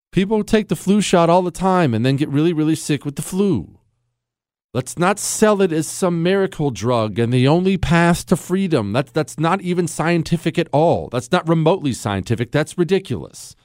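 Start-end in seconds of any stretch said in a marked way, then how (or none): none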